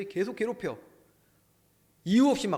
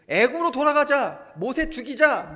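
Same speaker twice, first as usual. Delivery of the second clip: loud, raised call of a mutinous crowd; rebellious crowd cry; 9 semitones higher, 10 dB louder than usual. Retold or imitated. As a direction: call out like rebels rallying the congregation. Voices the rebelling congregation.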